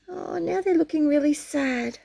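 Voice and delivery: ordinary delivery, nothing marked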